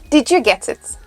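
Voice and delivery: usual speaking voice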